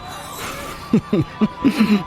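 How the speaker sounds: breathy mocking laugh; scoffing laugh. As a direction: laugh him off with contempt. Mocking the mention of Lucrezi.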